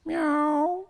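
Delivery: high, loud imitated meow; high-pitched feline mewl; cat's meow. A narrator's reading. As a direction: mew like a cat demanding attention. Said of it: "The cat mewed in answer".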